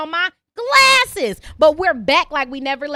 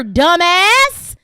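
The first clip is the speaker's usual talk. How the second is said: louder exasperated jab; loud insult; 8 dB louder than usual. Real, not acted. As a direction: punch it out louder. Insulting listeners on podcast.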